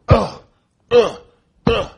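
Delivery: very high, very loud agonised grunts; imitated pained grunts. Men getting shot up close, loud pained grunts. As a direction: yelp like men being hit hard. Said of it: "And they're going".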